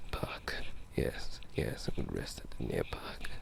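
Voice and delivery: ordinary talk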